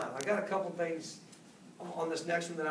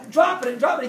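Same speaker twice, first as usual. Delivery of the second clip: mock shout; 6 semitones higher, 12 dB louder than usual. Retelling a throwing practice.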